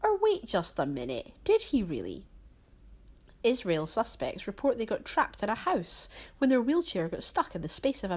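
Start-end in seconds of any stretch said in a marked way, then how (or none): none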